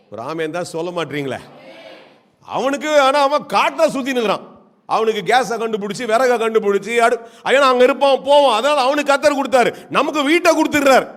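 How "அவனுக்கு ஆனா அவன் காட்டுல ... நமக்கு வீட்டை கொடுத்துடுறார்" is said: high-pitched, driving sermon delivery; animated pulpit argument; fervent preaching. Acted. Preaches with force.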